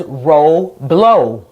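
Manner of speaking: "row blow" as two slow, separate syllables, each with long O; open syllables. Shown as a demonstration. Teacher showing Roblox without its X, "row blow" with long open vowels. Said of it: "would be read as".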